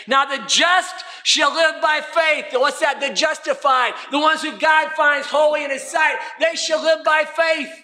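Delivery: high, urgent pulpit exhortation; full-force preaching. Acted. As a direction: preach it out to rally the congregation. Proclaims scripture to congregation.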